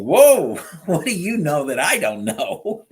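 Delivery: high, loud laughing "Whoa", then chuckling through the question; amused laughing surprise; laughing disbelief. Retold or imitated. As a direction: laugh it off, caught off guard. Laughing reply to a deep question.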